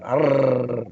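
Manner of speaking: Arr with a drawn-out rolled r; heavy rolled r. Shown as a demonstration. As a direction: trill the r in Arr. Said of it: "roll your R's excessively long".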